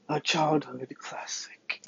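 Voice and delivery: soft, slow whisper. Pretend. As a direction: lean in and whisper it slowly. Dramatic hushed aside on topic.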